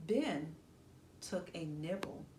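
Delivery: plain reading voice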